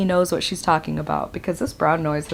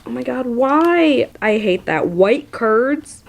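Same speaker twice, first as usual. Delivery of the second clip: ordinary talk, nothing marked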